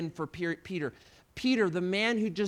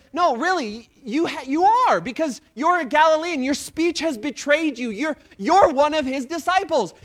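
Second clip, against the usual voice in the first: higher and louder, insistent accusation; pressing accuser; 5 semitones higher, 6 dB louder than usual. Acted. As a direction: corner him with it, higher and louder. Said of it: "she started pressing and pressing".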